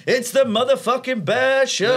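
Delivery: high, drawn-out bear-show entrance cry; mock hype announcer. Bear in chains bursting onto the stage, mock hype announcing. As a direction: announce it like a gatecrashing hype man.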